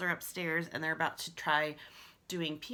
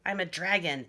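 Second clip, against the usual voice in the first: ordinary delivery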